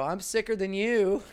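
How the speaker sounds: high, loud bragging; boastful. Retold or imitated. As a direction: brag it gleefully. Retold text to his buddy.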